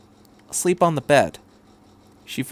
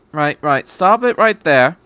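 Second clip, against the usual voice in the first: doctor cutting in, a touch louder; lightly voiced doctor; 4 semitones higher, 6 dB louder than usual. Doctor stopping Shinji mid-sentence, firm. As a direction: interrupt him firmly but kindly. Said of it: "said the doctor".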